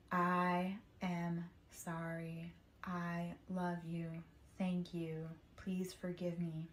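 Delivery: hushed, slow, gentle self-talk; soft and slow. Shown as a demonstration. Reader showing how to say it to yourself, soft and slow. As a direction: murmur it to yourself very slowly. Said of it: "say to yourself".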